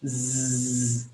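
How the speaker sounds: Z as a long, drawn-out buzz, zzz; sustained Z sound. Shown as a demonstration. Teacher showing the class the sound of X, long buzzed Z. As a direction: hold Z as one continuous zzz. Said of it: "The X is pronounced as".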